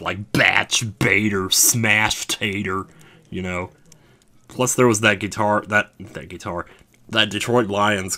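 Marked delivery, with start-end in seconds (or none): 0.3-2.8 "batch baiter, smash tater" hammered out slowly; drawn-out, punchy stress on each word of "batch baiter, smash tater"; punching words. Shown as a demonstration. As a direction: hit "batch" and "smash" like blows. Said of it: "sounds like you're beating somebody up".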